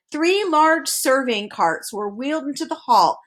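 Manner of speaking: ordinary delivery, nothing marked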